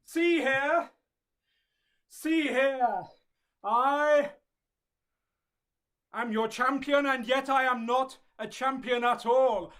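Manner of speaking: loud, grand theatrical declaiming; Shakespearean actor. Acted. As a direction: declaim it like an old stage hero. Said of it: "Shakespearean era sports star".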